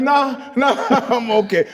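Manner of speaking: high, loud retold nah through laughter; laughing refusal. Client waving off the tattoo artist's offer, laughing refusal.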